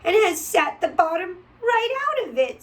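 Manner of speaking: high, rising little bear voice; squeaky cub, growing upset; baby bear upset about his chair; little wee bear. A narrator's reading. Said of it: "in his little small wee voice".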